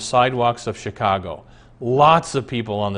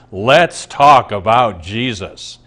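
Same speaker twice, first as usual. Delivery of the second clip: t-shirt slogan; 2 semitones lower, 6 dB louder, slower than usual. Acted.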